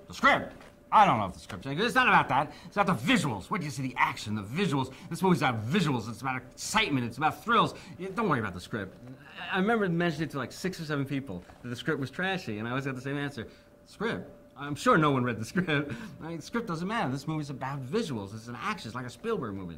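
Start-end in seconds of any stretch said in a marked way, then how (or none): 0.1-9.0 loud, higher, pushy producer voice; imitating Joel Silver. 13.8-19.8 higher, breezy brush-off; lightly voiced Hollywood type brushing off the script; mock dismissive answer. Imitating everyone's dismissive answer.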